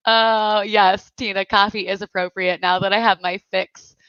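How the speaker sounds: usual speaking voice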